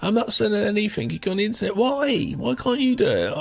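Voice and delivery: high, incredulous questioning; baffled stranger. Retold or imitated. Stranger baffled by the caller, high and incredulous.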